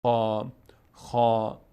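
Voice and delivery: خا said slowly with the mouth opened upward, full and heavy; heavy full kha. Shown as a demonstration. Teacher shows students the heavy خا sound, slowly. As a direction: stretch خا out slow and heavy.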